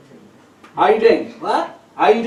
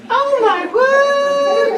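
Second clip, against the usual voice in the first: high, slow mock shriek of shock; shocked lady; much slower than usual. Mimicking a startled lady in the crowd, high slow shriek. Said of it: "The lady's like".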